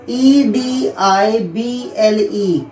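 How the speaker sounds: slow, letter by letter; spelling out. Shown as a demonstration. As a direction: spell each letter out slowly for the class. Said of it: "teacher spells out edible". (धीरे-धीरे एक-एक अक्षर बोलते हुए)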